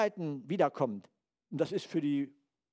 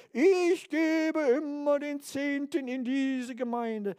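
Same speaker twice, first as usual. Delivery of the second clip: a lightly voiced pious boaster, higher and slow; smug giver; 10 semitones higher, 3 dB louder, much slower than usual. Congregant boasting of giving the tithe, mock smug and slow. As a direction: mimic a self-satisfied tither, drawn out. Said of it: "Und wenn du sagst".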